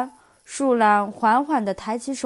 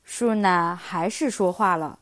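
ordinary delivery, nothing marked

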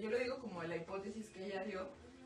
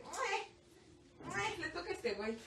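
high, whispery voice handing over; a high, breathy little inner voice; inner personality voice; 9 semitones higher, slower than usual. Retold or imitated. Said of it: "tu personalidad usual dice". (vocecita interna, aguda y susurrada)

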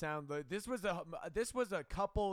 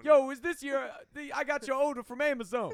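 loud, higher street-guy voice calling out; mock delivery guy; 6 semitones higher, 8 dB louder than usual. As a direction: bark it like a pushy delivery bro.

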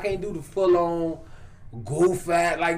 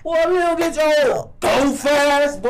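aggressive Chicago talk; 6 semitones higher, 4 dB louder, slower than usual. Retold or imitated. Chicago woman scolding a man, exaggerated aggression. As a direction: imitate an aggressive Chicago woman, stretching "goof ass". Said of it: "aggressive on certain words".

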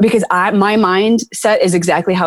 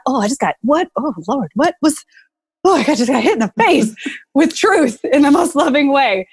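stunned surprise; 7 semitones higher than usual. Retold or imitated. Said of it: "you just be like".

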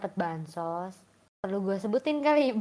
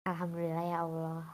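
soft, calm grateful prayer; soothing voice; 3 semitones lower, 8 dB quieter than usual. Real, not acted. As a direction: breathe it out softly, thankful. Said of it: "Aduh, suaranya adem".

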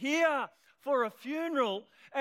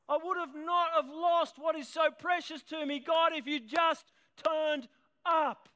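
anguished cry to God; 6 semitones higher than usual. Retold or imitated. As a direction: cry out your grief and blame at God. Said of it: "Have you ever said that to God".

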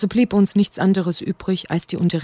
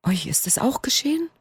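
breathless, high, frightened whisper; 7 semitones higher, 4 dB quieter than usual. A narrator's reading. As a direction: whisper it in shock. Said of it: "Erschrocken wandte er sich an uns".

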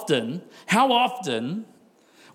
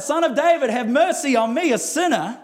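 high, raised plea for mercy; calling out; 7 semitones higher, 4 dB louder than usual. Acted. Blind man calling out to Jesus for mercy, raised plea. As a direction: cry it out, acted with preaching force. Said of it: "called out".

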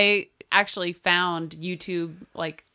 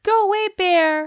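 high yelled "go away, bear"; scaring off a bear; 12 semitones higher, 5 dB louder than usual. Retold or imitated. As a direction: shoo the bear off with a high yell. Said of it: "the lady yelling at the bear".

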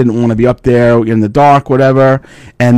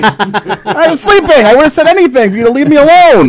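emphatic protest; 14 semitones higher than usual. Real, not acted. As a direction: insist he was asleep. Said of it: "emphatic protest".

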